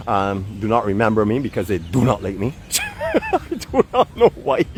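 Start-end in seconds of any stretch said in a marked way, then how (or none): 2.2-4.6 very high-pitched, laughing words. Real, not acted. Man joking that guys dislike him, high laughing voice. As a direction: squeal it slowly through laughter.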